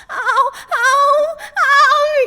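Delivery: loud high cramp cries; pained ow cries. Retold or imitated. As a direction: cry out from a leg cramp. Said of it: "literally being like".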